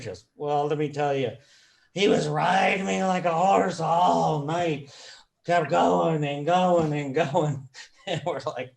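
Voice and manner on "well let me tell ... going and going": loud, drawn-out imitation of a tipsy wife. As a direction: play the wife spilling it, stretching the words louder and higher. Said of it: "she had a few drinks".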